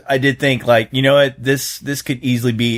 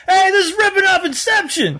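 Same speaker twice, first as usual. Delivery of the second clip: retold heckle. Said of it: "I screamed out".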